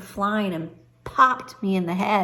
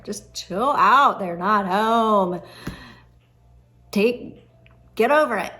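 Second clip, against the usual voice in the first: louder, slow, drawn-out chill out; mock teen brush-off; 4 dB louder, slower than usual. Teen on the phone telling her dad, louder and slower, chill out. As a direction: lay it on like a bored teenager. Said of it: "that's what we would say back there".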